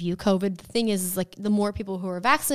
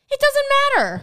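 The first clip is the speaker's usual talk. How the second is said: very high, louder mock protest; exasperated mock retort; 6 dB louder than usual. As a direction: squeal it in exasperation. Acted retort to Pfizer versus Moderna chat.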